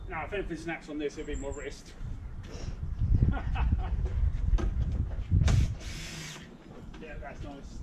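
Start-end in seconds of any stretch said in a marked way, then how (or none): 2.0-7.0 laugh. Real, not acted. Laughing at own joke.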